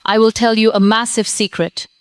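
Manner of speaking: ordinary talk, nothing marked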